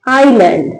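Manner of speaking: आइलैंड said as eye-land, falling at the end; silent S in island. Shown as a demonstration. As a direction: say आइलैंड with no S before the L. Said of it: "क्या पढ़ेंगे इसको".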